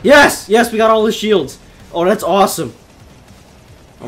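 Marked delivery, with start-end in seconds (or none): 0.0-1.6 high, loud yes, then elated report; triumphant. Real, not acted. Player who got all the shields, triumphant cry. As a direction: celebrate with a burst on yes.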